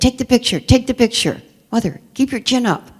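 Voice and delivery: a domineering wife giving orders; bossy wife. Retold or imitated.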